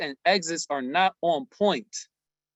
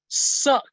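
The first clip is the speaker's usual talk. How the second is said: "suck" blurted high and loud, set off by a pause; "suck" as an emphatic burst of disgust; emphasis on suck; 9 semitones higher, 5 dB louder than usual. Real, not acted. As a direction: punch "suck" hard and airy. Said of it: "I'm emphasizing the word suck".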